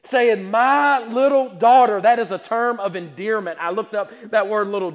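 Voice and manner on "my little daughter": loud, slow cry of a desperate father; pleading cry. Acted. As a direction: call out for your little girl. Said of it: "besought him greatly".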